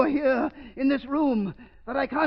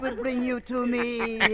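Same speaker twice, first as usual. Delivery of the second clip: ordinary delivery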